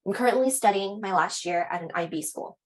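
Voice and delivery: normal talking voice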